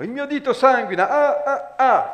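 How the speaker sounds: high, loud wailing about the bleeding finger; shrieking queen. A narrator's reading. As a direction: wail as the queen in a panic. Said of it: "gridava la regina".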